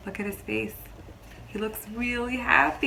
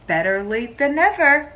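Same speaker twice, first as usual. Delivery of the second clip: higher, louder hopeful line from the father; father's hopeful thought; 6 semitones higher, 12 dB louder than usual. Acted. Teacher voicing Leo's father for the class, hopeful and louder. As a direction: lift it like a father trying to stay hopeful. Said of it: "thought Leo's father".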